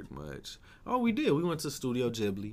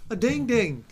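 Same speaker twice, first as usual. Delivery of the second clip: high, loud goofy singsong; silly-sounding imitation; 13 semitones higher, 9 dB louder, slower than usual. Making fun of British friend, high silly imitation. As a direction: mimic her silly-sounding words. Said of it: "silly ass sounding shit".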